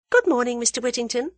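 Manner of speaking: quick, high, courteous farewell; politely. A narrator's reading. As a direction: take polite leave, brisk and high. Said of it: "she said politely".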